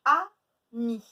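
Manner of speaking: AMI as loud a, pause, mi; split into syllables. Shown as a demonstration. Teacher giving pupils a new word, syllable by syllable. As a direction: break ami into two beats. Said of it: "deux syllabes".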